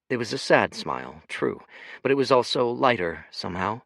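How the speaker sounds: ordinary delivery, nothing marked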